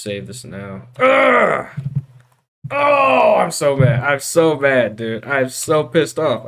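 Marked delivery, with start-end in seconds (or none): 1.0-3.4 loud, high, frustrated ugh and oh; frustrated yell. Real, not acted. Furious after losing work. 3.4-6.5 frustrated. Real, not acted. Furious after losing work.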